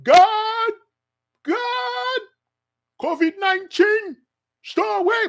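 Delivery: very high, drawn-out wailed plea; mock pastors' calling. Pastors calling God against covid, high mock wailing. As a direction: wail upward like a hammed-up praying pastor. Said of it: "just like the pastors".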